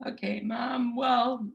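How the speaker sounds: son answering mom. Retold or imitated. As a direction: son sassing his mom, then softening. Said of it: "like, um".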